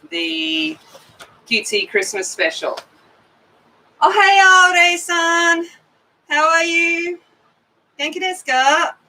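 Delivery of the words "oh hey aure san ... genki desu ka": higher, brighter hello to a friend; cheerful greeting. Real, not acted. Streamer greets viewer Aure-san in Japanese, upbeat and higher. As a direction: perk right up for a familiar face.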